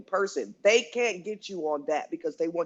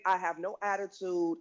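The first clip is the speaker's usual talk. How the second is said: normal talking voice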